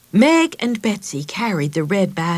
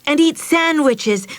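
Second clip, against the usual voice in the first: high, loud and happy "and eat sandwiches"; excited; 9 semitones higher, 5 dB louder than usual. Acted. Meg lists the picnic plans to Mother, excited. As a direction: list it with glee. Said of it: "she didn't stop talking".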